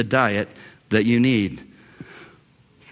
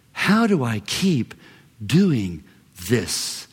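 slow and broken, falling lower on each word; weighted, weary question; 6 semitones lower, slower than usual. Acted. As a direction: let the question sink, word by word. Weary question repeated for emphasis.

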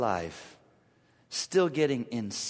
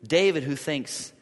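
normal talking voice

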